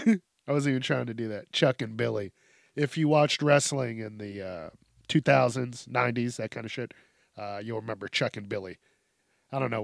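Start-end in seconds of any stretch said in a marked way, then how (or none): none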